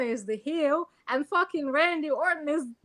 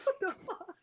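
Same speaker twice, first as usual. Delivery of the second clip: mock kid; 7 semitones higher, 8 dB quieter than usual. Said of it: "The kid was like".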